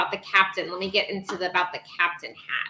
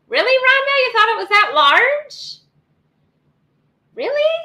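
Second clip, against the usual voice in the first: much higher, louder disbelief; Really, Rhonda? in playful disbelief; mock-shocked at a viewer; incredulous; 6 dB louder than usual. Real, not acted. Reacting to a viewer comment.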